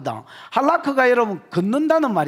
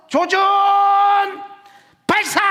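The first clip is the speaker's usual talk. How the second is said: mock military command; 10 semitones higher, 4 dB louder than usual. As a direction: call it out like an officer ordering archers. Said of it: "뭐 이런 소리가".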